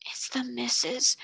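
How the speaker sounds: slow, frightened whisper; choked whisper. A narrator's reading. Servant girl terrified at the mistress's footsteps. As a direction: gasp it out in dread. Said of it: "choked Becky".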